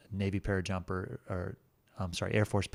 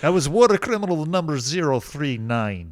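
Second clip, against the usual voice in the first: loud, higher, slow mock Russian-sounding accent; instructor's foreign accent; 6 semitones higher, 9 dB louder than usual. Survival school instructor addressing prisoner, loud mock Russian accent. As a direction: bark it out like a captor with a vaguely Russian accent. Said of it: "the instructors all did that sort of accent".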